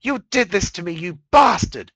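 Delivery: screaming at him in fury; furious scream. Acted. Mare screaming hatred at Discord, furious. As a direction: hurl it at him, full rage.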